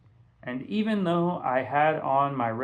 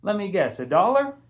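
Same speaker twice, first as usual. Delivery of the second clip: higher, louder cheeky comeback; teasing echo; 7 semitones higher, 5 dB louder than usual. A narrator's reading. Castle mimicking Mister Charles's guess back at him, cheeky higher tone. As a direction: throw his own line right back at him. Castle echoes the shopkeeper's tease.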